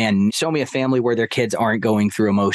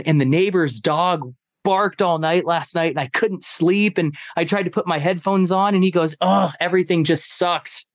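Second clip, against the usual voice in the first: put-on higher, whining rant of an upset boy; imitating whiny kid; 5 semitones higher than usual. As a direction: pitch up and pour out the complaints. Son's tearful rant voiced.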